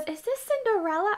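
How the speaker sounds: put-on high, questioning girlish voice; imitating a puzzled girl. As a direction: mimic her high confused question. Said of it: "she goes".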